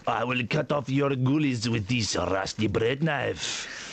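hammed-up French accent, dragging out the ending. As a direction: menace them as a mock Frenchman with a French accent. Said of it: "why I'm doing a French accent".

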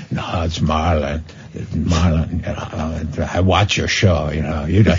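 low, slow, soft mumbling Brando; Marlon Brando. Retold or imitated. Brando on the phone to Dwight, low slow mumble. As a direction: drop way down and murmur it like Brando. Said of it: "he did his Marlon Brando".

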